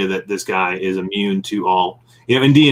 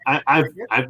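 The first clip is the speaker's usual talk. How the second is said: normal talking voice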